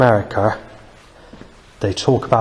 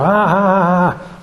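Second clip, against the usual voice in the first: high, drawn-out "ah, ah, ah"; high held wailing mimicry; imitated bike noise; 8 semitones higher, slower than usual. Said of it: "a loud bike occurs while in the church".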